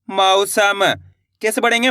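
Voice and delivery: ordinary talk, nothing marked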